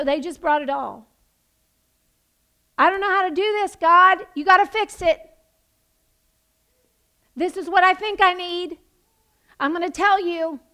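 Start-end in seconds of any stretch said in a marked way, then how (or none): 2.8-10.6 loud, raised, insistent plea; acted-out desperate demand, voice lifted; urgent plea to God. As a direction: plead forcefully with God. Acts out psalmists' blunt plea.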